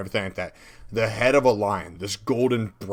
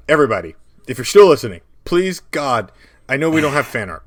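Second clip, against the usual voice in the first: loud, raised appeal to listeners; excited plea; 3 semitones higher, 8 dB louder, faster than usual. Real, not acted. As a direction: beg the audience at full energy. Pleading with listeners for fan art.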